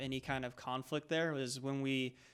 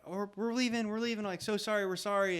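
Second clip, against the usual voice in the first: mildly flustered, higher-pitched apology; retold hasty apology; 6 semitones higher than usual. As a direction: imitate backing off apologetically, voice raised in pitch. Retold apology to approaching angry driver.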